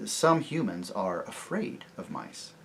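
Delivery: solemn, subdued delivery; very grave. A narrator's reading. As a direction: explain soberly, unhurried and quiet. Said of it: "said Peter very gravely".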